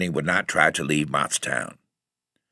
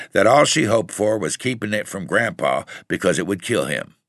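ordinary delivery

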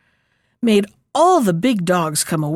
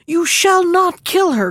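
high, louder cry of protest; a child crying out to stop it; sharp high cry, pushing to save her; cried out; 10 semitones higher, 4 dB louder than usual. Acted. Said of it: "cried her little daughter".

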